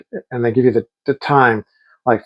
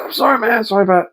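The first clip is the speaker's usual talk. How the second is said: higher, apologetic "I'm sorry, man"; retold apology; 9 semitones higher than usual. To the man whose driveway was blocked, a higher sheepish sorry.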